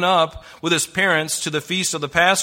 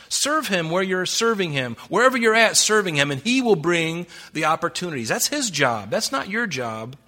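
ordinary talk

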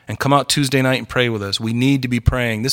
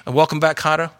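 ordinary talk

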